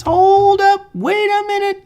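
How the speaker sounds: very high singsong halt; comically high-pitched stop everything; mock alarm. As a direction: squeal it out, drawn-out "hold". Halting show, wrong screen shown.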